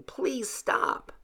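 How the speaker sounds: high, soft, mildly tearful plea; a little girl begging them to stop, understated; pleading. A narrator's reading. Daughter pleading with father and brother, mildly tearful. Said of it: "Mary Rose cried hysterically, pleading".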